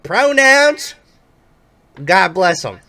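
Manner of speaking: high loud Southern drawl; Southern accent, big and friendly. Acted. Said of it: "very thick Southern accent".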